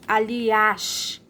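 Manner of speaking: "Aliás" with strong, long final ÁS; "Aliás" stretched, stressed open á at the end; strongest syllable shown. Shown as a demonstration. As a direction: lean on the ÁS of "Aliás". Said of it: "qual a sílaba mais forte".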